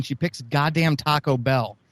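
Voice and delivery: ordinary talk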